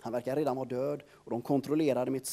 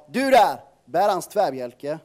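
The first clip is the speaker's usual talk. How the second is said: shouted order; 10 dB louder than usual. Acted. Soldier orders the narrator.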